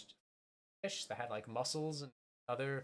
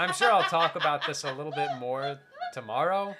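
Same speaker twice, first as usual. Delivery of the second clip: louder, talking over the laughter; insistent repeat; 8 dB louder than usual. Real, not acted. Speaker to a heckler who doubts it, loudly insistent. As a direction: push the line through over a heckler.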